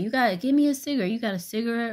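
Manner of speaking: high, fast, rude imitation of a pushy woman; rude demand. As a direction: snap it out like you're owed the cigarette. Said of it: "like, in a rude type of way".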